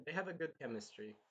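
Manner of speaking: ordinary talk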